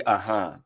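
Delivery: low, falling uh-huh, the second syllable dropping; signature uh-huh. Shown as a demonstration. Showing listeners the uh-huh they like, signature low uh-huh. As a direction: say "Uh-huh" low, settling downward at the end. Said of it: "they like the way I say".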